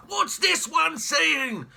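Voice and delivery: loud, very slow barked question; loud demand. Acted. Jeremy prodding Pippa with his cane, loud and demanding. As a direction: bark it at the little girl. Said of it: "cried Great Uncle Jeremy".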